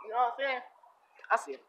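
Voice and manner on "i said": usual speaking voice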